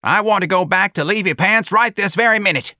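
put-on high, loud cranky old-lady demand; demanding old woman. A narrator's reading. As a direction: insist crossly in a high, loud voice.

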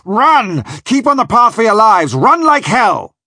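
ずっと高く大声で、最後はゆっくり怒鳴る; 逃げろと部下たちに叫ぶ船長; bawling. Acted.

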